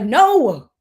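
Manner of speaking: loud, firm brush-off; brushing-off husband. Retold or imitated. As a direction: wave her off, not now. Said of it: "he's like".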